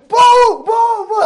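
very high, very loud boos; screaming crowd. Retold or imitated. Crowd screaming at the mayor as he walks, loud high boos. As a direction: blast out boos as an imitated mob. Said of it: "everybody's screaming".

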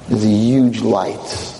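ordinary talk

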